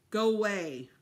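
put-on boy sends his father away, loud; sullen shout. A narrator's reading.

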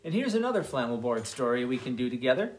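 usual speaking voice

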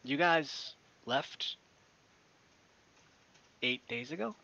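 slow, hushed disbelief; stunned disbelief. Acted. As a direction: let the shock land slowly. Merchant shocked.

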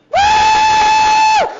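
very high, loud, long "woo"; mock-panicked scream. Jumper screaming back at the jump master, mock-panicked. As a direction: shriek it out in comic terror.

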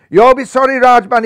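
villain screaming at the hero, furious; screamed threat. A narrator's reading. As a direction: scream it in a rage. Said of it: "he screamed".

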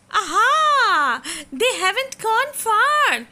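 high, loud eager cry; thrilled little fish, sure his school is near; excited little fish. Acted. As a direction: spot the glow and cheer. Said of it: "he said".

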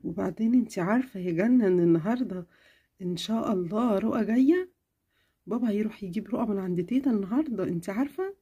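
mother answering her sick daughter, tenderly; caring mother. Acted. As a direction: soothe your little girl. Mother shares news.

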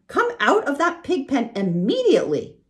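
mother crying out at her son. A narrator's reading. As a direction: demand he leave the pen. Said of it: "cried Missus Arabelle".

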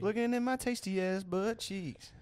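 high singsong brag; hammed-up tease. Teasing repeat of own line.

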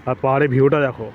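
normal talking voice

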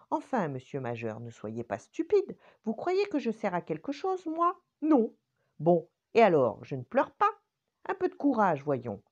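a prim finger scolds him to buck up; brisk scolding ring finger. Acted. Monsieur Annulaire scolds the weeping finger briskly.

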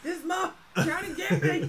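high, laughing mock panic; playful imitation of a frightened cry; imitated panic. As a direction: mimic a panicked caller through laughter. Listener imitates the comedian's panicked caller.

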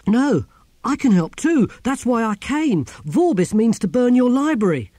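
high, loud, desperate plea; urgent protest. Acted. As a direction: blurt out the warning urgently. Priest urgently pleads to help.

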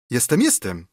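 ordinary delivery, nothing marked

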